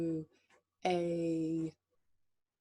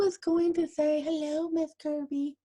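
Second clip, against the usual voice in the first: put-on high, louder child voice; eager kid voice; 8 semitones higher, 5 dB louder than usual. Bobby greets his teacher, high-pitched and eager. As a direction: chirp it like a nervous boy.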